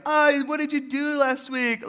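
mock hostess sweetness; fake-cheery small talk. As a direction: gush like a polite dinner guest. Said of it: "every time we get together with friends".

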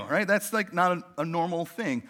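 ordinary talk

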